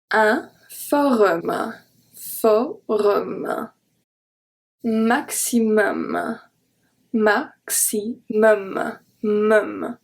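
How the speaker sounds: forum and maximum, very slow, with an open O in um; teacher drilling the um sound; slow careful pronunciation. Shown as a demonstration. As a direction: stretch out forum and maximum slowly. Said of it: "make the sound um and not um".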